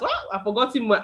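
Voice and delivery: ordinary talk, nothing marked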